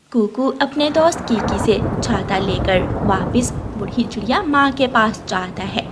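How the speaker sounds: ordinary talk, nothing marked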